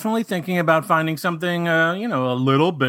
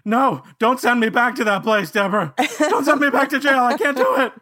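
mock panic; 7 semitones higher than usual. Panicked plea about jail.

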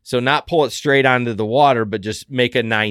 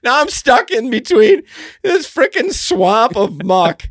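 pitched way up, half laughing at himself; high giddy exasperation; 11 semitones higher, 3 dB louder than usual. Real, not acted. Host stranded in muck tells cohost, giddy high voice. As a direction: squeal out the disaster, nearly cracking. Stranded in kayak without paddle.

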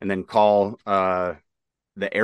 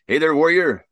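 higher, louder gruff sergeant greeting; Gunny Ortega's voice; 8 semitones higher, 6 dB louder than usual. Retold or imitated. Reading the sergeant's birthday text aloud in his higher, louder voice. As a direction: greet him like his old gunny would. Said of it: "I read his text in, in his voice".

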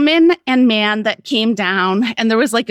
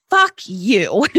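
1 defiant laughing curse; 3 semitones higher than usual. Retold or imitated. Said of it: "I just raised my arms and I was like".

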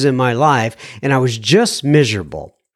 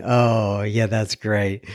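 ordinary talk

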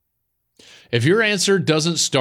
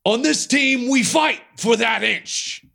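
much higher, slow, drawn-out movie pep-talk; a fan imitating the coach's big speech, high and slow; Pacino speech; 10 semitones higher, slower than usual. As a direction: ham up the famous locker-room line, slowing down. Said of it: "the Pacino speech".

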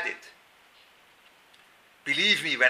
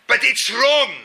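louder, higher protest; insisting; 10 semitones higher, 8 dB louder, slower than usual. Real, not acted. Speaker protesting his wife's way is wrong. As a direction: push back hard that it's wrong.

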